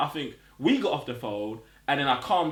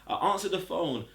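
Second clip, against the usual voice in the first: usual speaking voice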